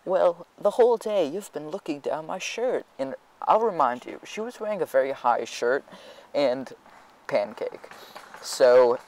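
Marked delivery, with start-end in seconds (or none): 0.1-2.8 higher mock accusing girl; lying accuser. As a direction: fake the wounded accusation. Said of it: "lie through her teeth and say".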